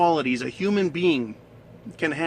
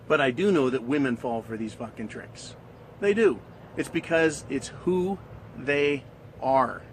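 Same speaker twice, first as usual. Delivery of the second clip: ordinary delivery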